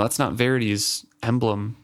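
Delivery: ordinary delivery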